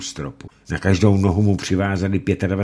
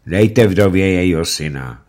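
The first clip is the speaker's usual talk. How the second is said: judge's order; 2 semitones higher, 8 dB louder than usual. Acted. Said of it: "řekl rychtář".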